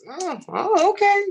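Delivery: high, loud surprised "oh, okay"; sudden high-pitched realization, almost shouted; startled loud exclamation. Retold or imitated. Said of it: "I was like".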